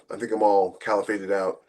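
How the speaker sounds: ordinary talk, nothing marked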